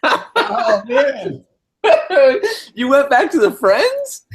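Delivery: high-pitched, helpless laughter; laughing. Real, not acted. Co-host hears the old intro, laughing hard. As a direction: laugh it out, cracking up. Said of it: "cracking up at old intro".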